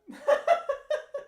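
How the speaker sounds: squealing high-pitched laughter. Real, not acted. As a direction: burst out laughing. Laughing at question.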